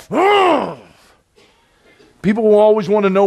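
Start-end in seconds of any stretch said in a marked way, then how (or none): 0.1-0.8 high exasperated ugh; angry grunt. Retold or imitated. Preacher imitating an angry child, high frustrated ugh. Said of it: "which is anger, like".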